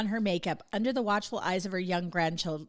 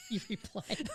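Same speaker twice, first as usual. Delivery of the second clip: laughing. Real, not acted. Laughing at own joke.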